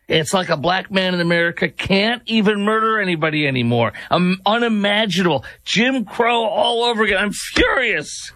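sarcastic mock fury, climbing in pitch; mock outrage. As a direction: pretend outrage, dripping with sarcasm. Reading a sarcastic tweet aloud.

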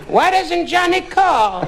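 put-on high, slow, plaintive old mother; a mother pining for her son; mother on the porch. Parents on the porch waiting for Johnny, plaintive and high. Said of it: "mother and father ... going".

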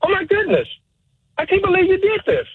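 hammed-up shocked line in a mock audition. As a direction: act out the shock of a betrayal. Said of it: "I'm shocked".

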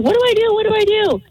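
high-pitched, panicky retelling; frantic high mock wail; tearful panic. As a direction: re-enact a helpless, panicked plea. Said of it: "I start crying".

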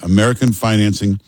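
ordinary talk, nothing marked

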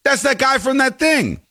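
vague guy-naming. Retold or imitated. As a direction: mimic someone who can't recall the name. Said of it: "instead of saying Viggo Mortensen".

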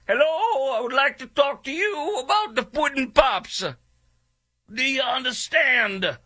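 horrible, very high-pitched comic impersonation; hammed-up high voice, slowing on the last line; Bill Cosby. Radio host doing a bad Bill Cosby about Puddin' Pops, hammed-up impersonation. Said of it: "There's my horrible impersonation".

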